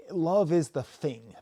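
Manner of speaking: "thing" said as "fing", with an F; TH fronting. Shown as a demonstration. Quoting the album's repeated phrase, "thing" said as "fing". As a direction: swap the TH of "thing" for an F. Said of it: "That's called T H fronting".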